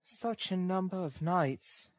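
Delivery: put-on high, slow, tender girl's voice; gentle, sweet-toned girl; touch of tenderness. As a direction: say it sweetly and slowly, high in pitch. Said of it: "with a touch of woman's tenderness".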